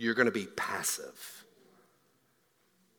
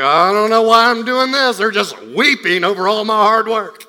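louder, higher exasperated builder; defeated builder's complaint; 8 semitones higher, 14 dB louder than usual. Acted. Discouraged temple builder complaining about the weeping, exasperated. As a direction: whine it out like a worn-out worker.

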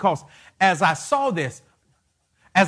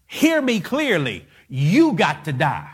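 full-force preaching; 3 semitones higher, slower than usual. Acted. Preacher commanding his congregation to die to self, shouting. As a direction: pound every line at the church like a revival preacher.

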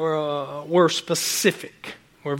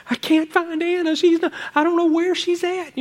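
frantic mom; 10 semitones higher than usual. Retold or imitated. Mom panicking over missing child.

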